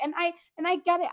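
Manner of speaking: usual speaking voice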